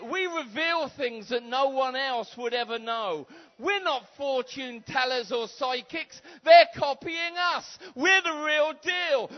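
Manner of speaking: loud, high, driving preacher's call; preaching at full force. Acted. Preacher rousing the church to ask God, fiery preaching. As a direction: whip the crowd up from the pulpit.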